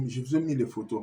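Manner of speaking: ordinary talk, nothing marked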